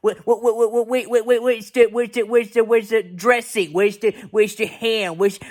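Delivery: imitating a furious girl yelling about food; mock angry rant. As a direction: rant at Charlie Brown, getting shriller. Peppermint Patty losing her shit.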